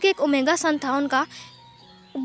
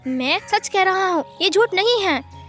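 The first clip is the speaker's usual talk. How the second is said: higher-pitched, pleading insistence; insistent protest; 4 semitones higher than usual. Acted. Ni Husain telling disbelieving friends the village's story, insistent protest. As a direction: defend your story urgently, pitch raised. Doubted character defends his story.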